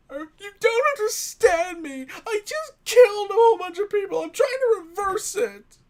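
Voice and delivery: high, loud, frantic cry; panicked outburst. Acted. Ronnie panicking to his professor, high frantic shout.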